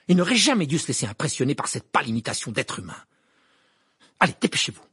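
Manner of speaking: loud, indignant, urgent; in a rage. A narrator's reading. Hermione hurries her friends along, raging. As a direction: rush it out angrily and order them along. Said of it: "dans une rage semblable".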